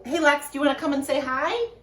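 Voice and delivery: usual speaking voice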